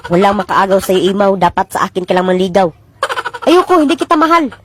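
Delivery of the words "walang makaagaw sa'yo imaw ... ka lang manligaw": put-on low, possessive old lady; low jealous crone voice; old woman voice. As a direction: claim him like a jealous crone.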